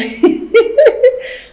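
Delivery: a squeaky, airy high giggle; high breathy giggle. Acted. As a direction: burst out again. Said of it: "Nicole n'arrête pas de rire".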